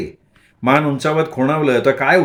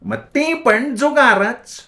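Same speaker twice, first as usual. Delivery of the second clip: much higher, slow, mad voice; crazed gleeful; 10 semitones higher, much slower than usual. A narrator's reading. Bhagi mocking the boys, much higher and slow. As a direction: drawl it out high like a madwoman. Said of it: "ती वेड्यागत हसत म्हणाली".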